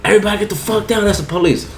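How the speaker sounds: high, fast retelling of the cop yell; quick half-voiced cop call; mock police shout. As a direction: lighter replay of the raid call. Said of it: "so fucking I said".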